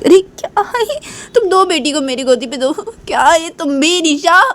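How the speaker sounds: very high-pitched, exasperated protest; acted high-voiced reproach; alarmed rebuke. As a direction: scold in shocked dismay. Alarmed rebuke over crying baby.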